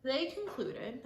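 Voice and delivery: usual speaking voice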